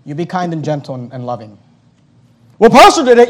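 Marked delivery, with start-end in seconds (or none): 2.6-3.3 high, loud mock whine of an excuse; whiny excuse. Pastor mocking a member's excuse, high mock whine. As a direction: mimic a church member's lame excuse. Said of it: "mocking a church member's excuse".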